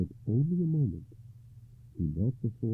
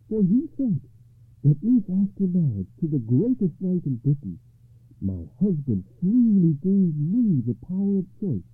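higher, louder triumphant lady; joyful lady; 9 semitones higher, 10 dB louder than usual. A narrator's reading. Ragnall tells Gawain the curse is ended, joyful and louder. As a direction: ring out that the spell is broken. Ragnall rejoices, spell broken.

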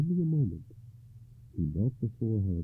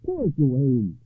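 loud, high pitying cry; pitying knight; 7 semitones higher, 10 dB louder, slower than usual. Acted. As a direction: pity him out loud. Knight pities Gawain.

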